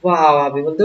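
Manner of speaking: ordinary talk, nothing marked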